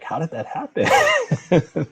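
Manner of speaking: slow, high, laughing disbelief; drawn-out amazed laugh at how fast it went. Retold or imitated. Father marveling at his son nearly four, slow amazed laugh. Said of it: "and I'm like".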